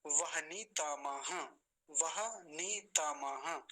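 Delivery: वहनी तमह spelled out very slowly, light half h; syllable by syllable, वहनी तमह; slow model reading. Shown as a demonstration. Teacher repeating the correct word slowly for the class. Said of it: "हल्का ही उच्चारण आएगा".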